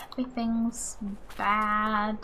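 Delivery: ordinary delivery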